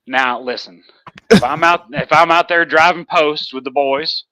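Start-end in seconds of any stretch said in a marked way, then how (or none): 1.3-4.2 thick Texas accent. Shown as a demonstration. Proving their roots to a doubter, thick Texas accent. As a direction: lay the Texas twang on, louder. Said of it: "I can lay it on thick".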